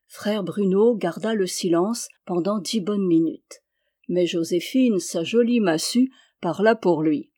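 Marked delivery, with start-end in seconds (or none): none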